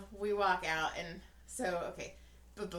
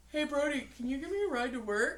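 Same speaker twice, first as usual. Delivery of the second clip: laughing mock request, higher and louder; mocking brother; 6 semitones higher, 5 dB louder, faster than usual. Son mocking older brother asking for a ride, laughing. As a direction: ham up the little brother's ask, laughing. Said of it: "and he goes".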